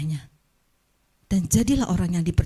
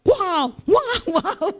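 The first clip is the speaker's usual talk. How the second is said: mock tiger roar; 10 semitones higher, 4 dB louder, much slower than usual. Tiger roaring back, laughing.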